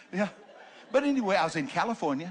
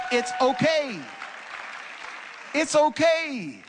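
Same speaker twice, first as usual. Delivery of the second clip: shouted reassurance; 8 semitones higher, 5 dB louder, slower than usual. Real, not acted. Reassuring shouted it's okay.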